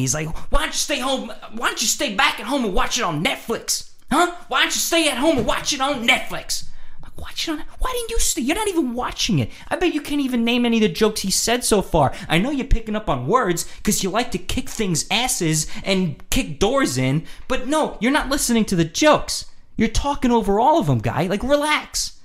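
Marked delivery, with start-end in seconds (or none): 0.5-6.6 put-on high-pitched drunk heckling; mock yelling. Drunk guy yelling other things at me, mock high yell. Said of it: "he starts yelling other things at me". 7.2-22.1 high, worked-up scolding; exasperated comeback. Retold or imitated. Telling off the guy talking over jokes, exasperated high rant.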